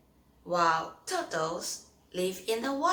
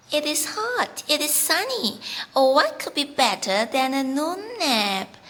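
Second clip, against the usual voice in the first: 1 lazy bunny voice; 6 semitones higher, 8 dB louder than usual. Acted. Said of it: "says Mister Bunny".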